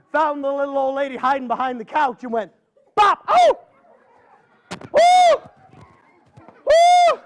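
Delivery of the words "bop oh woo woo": shouted bop, then very high loud woo cries; a struck granny howling as she flees; loud bop and yelps. Acted. Gourd hits old lady again.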